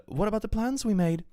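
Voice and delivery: high, lightly voiced plea; a hint of a wounded question; pleading. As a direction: ask it like a hurt girlfriend. Reading her own plea aloud.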